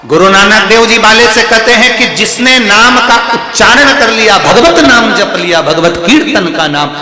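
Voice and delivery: high, loud preaching with rising passion; ringing katha sermon voice; fervent preaching. Acted. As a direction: lift the crowd with devotion. Preacher at full force.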